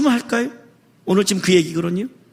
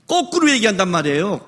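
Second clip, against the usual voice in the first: ordinary talk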